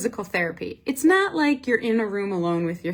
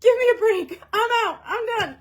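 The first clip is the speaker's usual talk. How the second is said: high, strained plea to stop; high desperate surrender; desperate pleading; 11 semitones higher than usual. Retold or imitated. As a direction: beg to quit, voice pitched way up. Begging for a break in agony.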